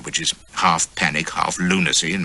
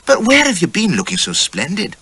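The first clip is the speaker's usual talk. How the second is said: put-on high, coaxing young woman's voice; pry playfully, pitched high; curious wife; 12 semitones higher than usual. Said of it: "she laughed at me in the mirror".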